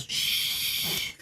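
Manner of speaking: long, forceful hissed shush; very loud shush. Acted. Knack trying to silence Socks, over-loud shush. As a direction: shush hard, far too loudly. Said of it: "Very loudly".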